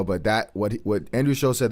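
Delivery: usual speaking voice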